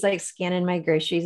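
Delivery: ordinary talk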